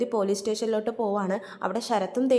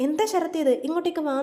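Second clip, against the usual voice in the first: higher, startled and affectionate; surprised big brother, pulling him up; surprised protest; 7 semitones higher, 3 dB louder than usual. Retold or imitated. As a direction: catch him before he bows down. Sachi stops Sarath touching feet.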